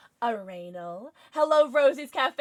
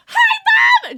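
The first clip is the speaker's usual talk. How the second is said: high, loud squeal of hello; real delighted shout; excited shout; 12 dB louder than usual. Real, not acted. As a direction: squeal hi to a fan's mom. Greeting a friend's mother.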